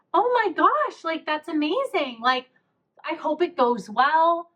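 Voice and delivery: high-pitched, drawn-out imitated gushing; gushing encouragement. Lady behind in line wishing her well, gushing. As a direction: mimic a thrilled, supportive stranger.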